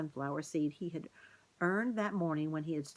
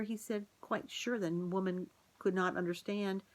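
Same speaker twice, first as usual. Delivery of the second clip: usual speaking voice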